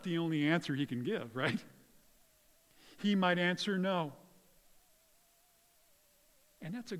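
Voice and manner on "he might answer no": normal talking voice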